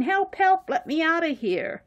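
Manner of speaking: high-pitched little character crying help; Little Miss Macy. Acted. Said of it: "little Miss Macy shows up, and she's going".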